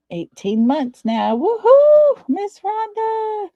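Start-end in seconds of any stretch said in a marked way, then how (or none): 1.4-3.5 high, loud, delighted whoop then a drawn-out sung name; excited woohoo. Real, not acted. Host cheering a viewer joining the live chat, excited.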